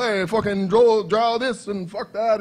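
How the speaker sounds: hammed-up mobster swagger; gangster bit. Entertaining passers-by at the booth, loud mock gangster. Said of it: "going on and on and on about".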